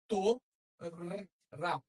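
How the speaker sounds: "To," then "ra." as separate sounded syllables; syllables split. Shown as a demonstration. Teacher splitting a Hebrew word into two sounded syllables. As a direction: say "To" and "ra" apart as two beats. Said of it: "Mesma mecânica".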